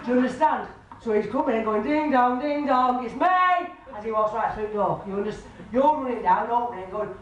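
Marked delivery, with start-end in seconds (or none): 1.8-3.3 imitated doorbell and cheery announcement; calling out. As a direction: sing out an arrival at the door, higher. Spirit announces himself at the door.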